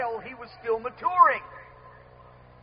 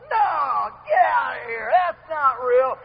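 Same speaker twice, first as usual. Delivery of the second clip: mock shout of disbelief; disbelieving; slower than usual. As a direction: wave it away as too good to be true. Listener refuses God's welcome.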